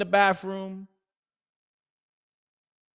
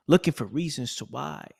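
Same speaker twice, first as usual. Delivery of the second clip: usual speaking voice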